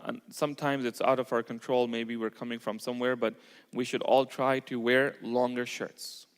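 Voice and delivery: normal talking voice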